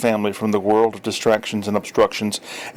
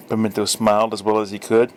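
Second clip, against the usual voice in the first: ordinary delivery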